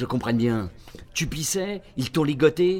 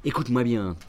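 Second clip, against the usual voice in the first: usual speaking voice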